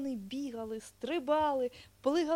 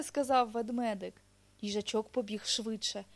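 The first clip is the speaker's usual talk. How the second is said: ordinary delivery, nothing marked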